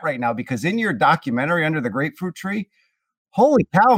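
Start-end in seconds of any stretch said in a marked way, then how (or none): 3.3-4.0 a high, loud holy cow of real amazement; amazed exclamation. Real, not acted. Host marvels at the pitcher's new muscles, amazed. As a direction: blurt it out, genuinely impressed.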